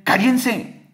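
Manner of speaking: high sharp hush-up order; crowd shouting. Retold or imitated. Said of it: "le gritó la multitud".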